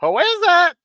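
high squawky parrot asking who's there; a parrot calling through the door. Retold or imitated. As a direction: chirp it out like a talking parrot. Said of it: "the lady's parrot, uh, says".

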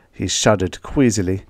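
usual speaking voice

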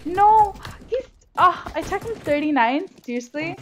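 high, frustrated cry of disbelief; dismayed outcry. Real, not acted. Gamer who tagged an enemy and still lost, dismayed outcry. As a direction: cry out in protest, pitched high. Dismay after losing fight.

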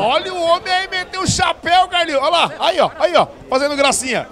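high, loud delighted call; excited. Real, not acted. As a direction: cheer the trick shot. Jogada de efeito ao vivo. (narração empolgada)

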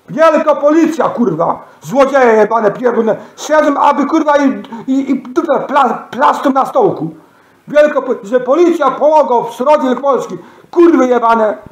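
angry ranting. Real, not acted. Furious rant insulting the police. (gniewna tyrada podniesionym głosem)

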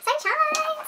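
playful exclamation. Pretend.

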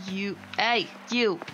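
ordinary talk, nothing marked

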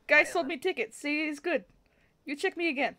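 eager chancer talking his way in; pleading cheery traveller. Acted. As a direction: plead your case cheerfully.

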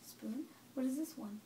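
put-on quiet, gentle questions to a baby; soft parent voice. Parent quietly quizzes the baby on body parts and objects. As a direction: ask softly, near a whisper.

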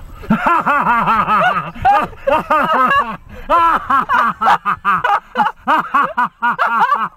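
long, high-pitched fit of laughter; hearty laughter. Real, not acted. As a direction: fall apart laughing at your own punchline. Cracks up at final punchline.

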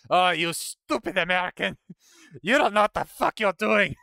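loud, high mock French outrage; angry French person. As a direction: scold in a hammed-up French accent. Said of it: "some French person is gonna come in".

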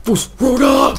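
high, loud mock war shout; hammed-up warrior yell; battle cry. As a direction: bellow it like a magic shout.

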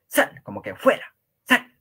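"sal" como orden, mucho más alto; commanding "sal". Shown as a demonstration. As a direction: mandar a alguien fuera: "¡sal!". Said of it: "como imperativo sería sal". (orden imperativa, voz aguda y firme)

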